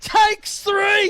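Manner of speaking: very high-pitched commentator's shout; excited shouted call. Real, not acted. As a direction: peak of a building play-by-play count. Counting bounces on a run.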